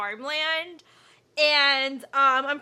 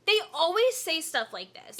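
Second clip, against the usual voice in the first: ordinary delivery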